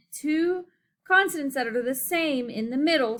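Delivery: ordinary delivery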